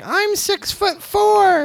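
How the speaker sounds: very high, slow squeal; little high squealy voice. Retold or imitated. Kid brags to dad about his height, high squealy voice. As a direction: imitate a kid boasting in a drawn-out squeak. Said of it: "he said it in that little high squealy voice".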